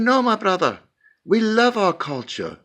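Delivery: put-on lightly done African accent, heritage-proud; African pastor accent. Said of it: "Do you like my accent".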